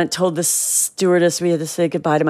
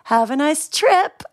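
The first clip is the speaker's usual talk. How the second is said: slow, higher, stunned farewell; dazed stewardess; 9 semitones higher, slower than usual. Retold or imitated. Shaken stewardess seeing the girls off, slow and dazed. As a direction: say goodbye as if in shock. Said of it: "she was in a daze, so scared".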